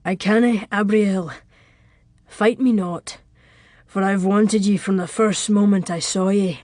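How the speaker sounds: ardent lover with a Scottish burr; intimate, earnest Scottish brogue; thick Scottish burr. A narrator's reading. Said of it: "His burr had thickened erotically".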